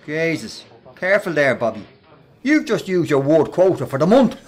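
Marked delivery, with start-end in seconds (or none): 2.4-4.5 loud jeer breaking into laughter; mocking laugh. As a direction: laugh at him as you say it. [name] laughs at Bobby.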